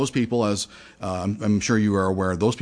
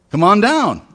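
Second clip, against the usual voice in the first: yelling up; 9 semitones higher, 6 dB louder than usual. Acted. Powerboat man yells up to roof.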